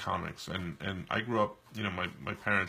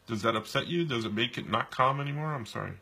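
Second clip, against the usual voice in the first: ordinary talk, nothing marked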